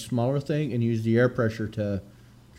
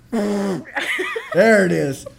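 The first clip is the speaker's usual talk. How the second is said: laughing; 6 semitones higher, 4 dB louder, much slower than usual. Real, not acted. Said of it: "Let's do it".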